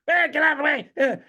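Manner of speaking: high mock yell of road rage; angry driver. Storyteller imitates the rude driver passing by, high angry yell. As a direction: yell it like a jerk behind the wheel. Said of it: "this guy who's like".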